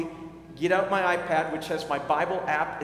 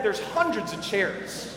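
ordinary talk, nothing marked

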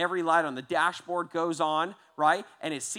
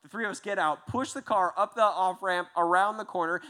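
ordinary talk

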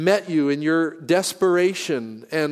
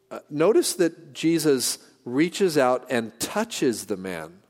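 ordinary delivery

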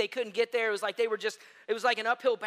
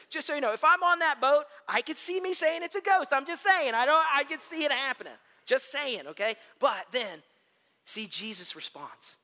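usual speaking voice

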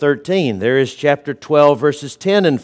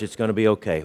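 calm reassurance; 4 semitones lower, 7 dB quieter, much faster than usual. Retold or imitated. Pastor voicing his friend's calm refrain, softly reassuring. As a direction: drop softer and calmer for the refrain.

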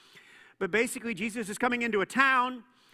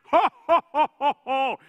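loud, much higher hammed-up ho ho ho; jolly mock Santa belly laugh; 6 semitones higher, 11 dB louder than usual. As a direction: boom it out like Santa on his sleigh. Santa flying over cities.

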